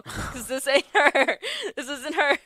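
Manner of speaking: high, loud, slow line dissolving into laughter; mocking the fake doll while cracking up; laughing. Real, not acted. Joking to co-host about knockoff Wicked dolls, laughing through it.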